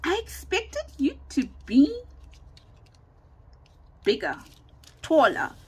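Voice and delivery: hesitant, high-pitched mock astonishment with long pauses; acting out a taken-aback colleague; retold disbelief. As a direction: imitate someone baffled, groping for the tactful word. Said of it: "Then he says".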